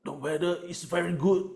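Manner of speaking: mock dying grandfather. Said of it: "the grandfather said".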